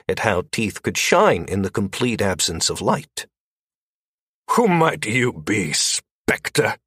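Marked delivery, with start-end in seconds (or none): 4.5-6.8 authoritative. A narrator's reading. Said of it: "in the tone of someone who was used to being the authority".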